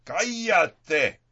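shouted command. Acted. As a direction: shout it with full authority. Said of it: "y grita". (grito enfurecido y agudo)

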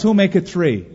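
auctioneer's cry. Acted. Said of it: "he cried".

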